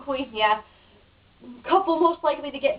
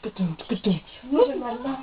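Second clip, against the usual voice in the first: copying the interviewer; much slower than usual. Retold or imitated. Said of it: "I'm just copying you".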